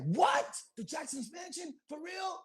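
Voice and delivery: high-pitched starstruck disbelief; imitated astonishment. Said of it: "We was like".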